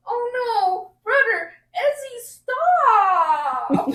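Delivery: high, loud drawn-out wail; mock toddler whine, stretching the last word; imitating upset toddler. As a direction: protest like a toddler losing a toy.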